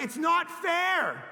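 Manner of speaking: put-on slow, loud, high kid's protest; whining daughter. Daughter protesting to dad, slow, loud whine. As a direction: stretch the complaint like a wronged child. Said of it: "She's like".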